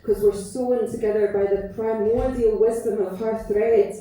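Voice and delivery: measured, lilting poem recital, leaning on each line; spoken word poem. Acted. Performer recites her poem about Gran to audience, tender recital. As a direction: let the verses breathe like a love letter to Gran.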